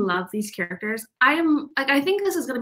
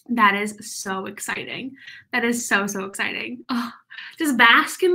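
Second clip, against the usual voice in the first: ordinary talk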